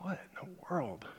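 whispered aside in disbelief; hushed what-in-the-world whisper; bewildered whisper. Retold or imitated. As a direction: breathe it to yourself, stunned. Retold private bewildered reaction.

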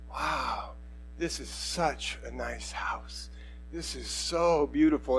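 soft, slow, hushed wonder; wide-eyed awe. Retold or imitated. Visitor admiring a simple house, imitated hushed awe. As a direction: imitate someone awestruck, lingering on the words. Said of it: "wide-eyed, like".

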